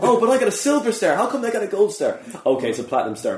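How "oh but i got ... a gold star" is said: put-on high, indignant kid voice; mock whiny child. Mocking a child jealous of another's gold star. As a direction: whine like a kid cheated of gold.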